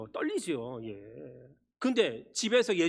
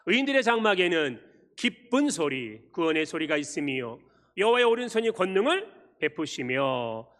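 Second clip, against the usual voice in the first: solemn scripture reading; 4 semitones lower, 5 dB louder than usual. Real, not acted. Pastor leading the congregation in the psalm, solemn reading. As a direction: lead the congregation through the verse.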